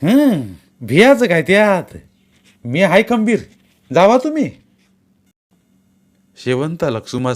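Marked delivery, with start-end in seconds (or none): 0.0-4.5 higher, louder, defiant insistence that she's not afraid; bold reassurance. Acted. Shevanta insists she isn't scared, bold raised voice. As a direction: brave it out, chin up. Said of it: "न राहून म्हणाली".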